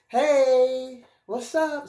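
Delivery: high, sing-out casual hello; flirty text greeting. Acted. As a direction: toss out a high, drawn-out texting greeting. Voicing a casual flirty text message.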